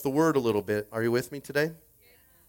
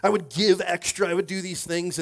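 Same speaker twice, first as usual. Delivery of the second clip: acted prayer, growing more insistent; earnest bargaining; 3 semitones higher, 3 dB louder than usual. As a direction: pile up eager vows to God. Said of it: "We're like".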